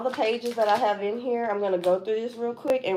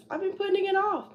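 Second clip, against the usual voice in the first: 1 high exasperated whine; 12 semitones higher, faster than usual. Real, not acted. Frustrated self-admission.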